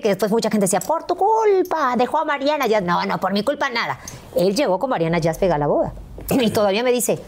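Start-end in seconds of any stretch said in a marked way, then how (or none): 0.8-2.7 high, drawn-out mock blame; mock accusing. Fans mockingly blaming her that he left Mariana.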